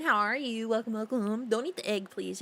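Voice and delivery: ordinary talk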